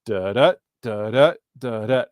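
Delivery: "da, da" in pairs, second da hit harder; sounding out the meter on "da, da"; iambic beat. Shown as a demonstration. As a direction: tap out "da, da" as weak-strong beats. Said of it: "'cause it goes".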